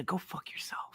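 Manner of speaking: breathy whisper, no voice at all; whispered curse. Real, not acted. Telling John Cena off on R-Truth's behalf, in a whisper. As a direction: hiss the insult under your breath.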